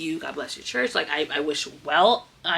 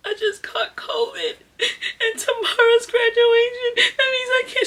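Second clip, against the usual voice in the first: crying; 4 dB louder than usual. Retold or imitated. Telling her principal she'll miss graduation, crying. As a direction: wail it out through tears. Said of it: "I called my principal crying".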